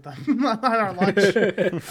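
can't hold back the laugh mid-sentence; laughing while telling. Real, not acted. Telling friends about lunch breaks, laughing through it. Recalling smoking weed at lunch.

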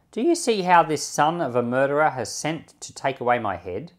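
ordinary talk

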